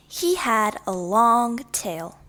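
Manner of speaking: plain reading voice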